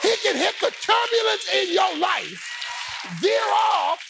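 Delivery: high, shouted sermon climax; full-force preaching. Real, not acted.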